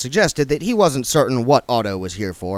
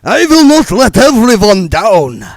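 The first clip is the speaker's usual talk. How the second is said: high, slow, very loud scream; vampire screaming his resolve; 11 semitones higher, 13 dB louder than usual. Acted. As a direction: shout out his vow. Otto keeps screaming vow.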